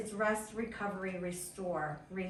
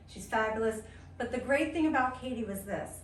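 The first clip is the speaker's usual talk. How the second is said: ordinary talk, nothing marked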